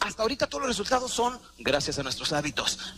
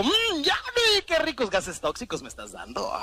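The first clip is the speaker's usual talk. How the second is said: higher, slow sarcastic savoring; mock delight; 7 semitones higher, slower than usual. The body pretending to enjoy cigarette smoke, sarcastic. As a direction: ironically relish the toxic smoke. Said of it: "¿O a poco dijo".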